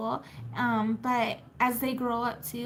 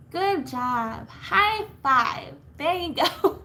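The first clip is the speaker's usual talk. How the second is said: real cheering on; 3 semitones higher, 5 dB louder, much slower than usual. Real, not acted. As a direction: celebrate them loudly, pitched up. Cheering a viewer on.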